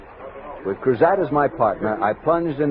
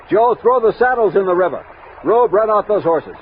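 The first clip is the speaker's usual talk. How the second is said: raised voice barking orders to the men; commanding orders; 6 semitones higher, 3 dB louder than usual. Acted. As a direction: call out orders over the river. Captain giving orders to men.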